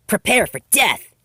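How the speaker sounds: playful fake war cry; mock battle shout. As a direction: challenge her to a pretend duel, full volume. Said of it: "she shouted".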